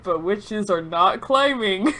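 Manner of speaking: usual speaking voice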